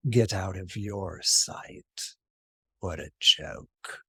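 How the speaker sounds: slow, low, soft disdain; scornful goddess. Acted. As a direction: drop low and drag the words out with contempt.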